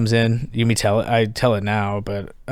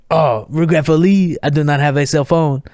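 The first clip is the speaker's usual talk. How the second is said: Jock; 4 semitones higher than usual. Retold or imitated. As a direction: imitate a Frenchman politely declining. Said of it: "And he goes".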